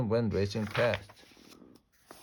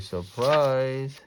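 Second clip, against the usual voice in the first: higher, louder party shout; storybook cheer, building louder; shouted surprise; 4 semitones higher, 7 dB louder, much slower than usual. A narrator's reading. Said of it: "all the ladies shouted".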